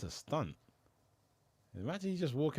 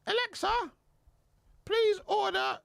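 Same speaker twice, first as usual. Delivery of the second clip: squawky, very high parrot talk; 5 dB louder than usual. Acted. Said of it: "but the parrot though".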